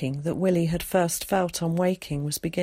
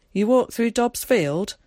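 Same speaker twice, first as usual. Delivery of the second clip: sharp questioning, higher and louder; 5 semitones higher, 7 dB louder than usual. A narrator's reading. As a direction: challenge the visitor with a brisk, pointed question. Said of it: "asked Tom sharply".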